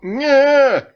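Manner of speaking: very high, loud yelp of fright; startled cry. Acted. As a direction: yelp as you're attacked. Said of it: "Fritz cried out".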